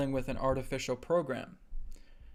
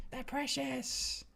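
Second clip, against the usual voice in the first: much higher, slow hissing Gollum croon; 12 semitones higher, much slower than usual. Retold or imitated. Teacher doing Gollum cooing over the ring, high and slow. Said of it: "the Gollum Lord of the Rings example".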